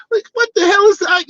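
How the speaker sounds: high-pitched, baffled outburst; incredulous. Real, not acted. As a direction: voice leaps way up in disbelief. Baffled reaction to the imitated dialogue.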